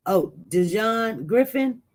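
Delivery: ordinary talk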